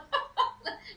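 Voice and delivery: light airy chuckle; breathy little laugh; soft laugh. Real, not acted. Laughs after Dorothy joke.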